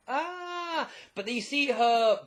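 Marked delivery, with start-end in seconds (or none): 0.1-0.9 mock scream. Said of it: "like this, like".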